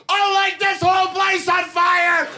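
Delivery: very high, full-out crazed scream; ironically "calm" hysterical shriek; mock-calm screaming threat. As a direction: scream the threat, completely unhinged. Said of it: "And very calmly".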